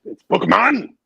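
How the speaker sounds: loud mock German "Bookman"; put-on German accent on "Bookman"; mock German surname. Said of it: "saying my last name the wrong way".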